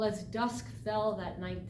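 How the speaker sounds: ordinary delivery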